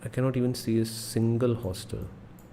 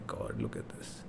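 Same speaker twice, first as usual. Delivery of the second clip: hushed whisper in awe; 13 semitones lower, 13 dB quieter than usual. Real, not acted. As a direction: breathe it out, barely voiced. Amazed at the screen, hushed.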